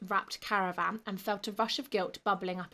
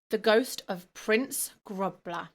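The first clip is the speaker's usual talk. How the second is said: usual speaking voice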